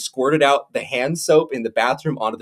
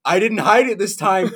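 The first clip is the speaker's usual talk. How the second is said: much higher, slow, gloating put-on dad; mock dad voice; 10 semitones higher, 5 dB louder, much slower than usual. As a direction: draw out trouble with smug glee. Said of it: "He goes".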